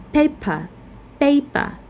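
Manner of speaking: "paper" stressed on PA; "paper" as PA-per, twice; first-syllable stress. Shown as a demonstration. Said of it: "we stress at the first syllable".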